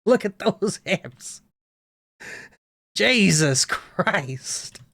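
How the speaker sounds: slow, laughing through the words; real laugh breaking in. Real, not acted. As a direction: giggle while pointing it out. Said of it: "Her hips are crazy".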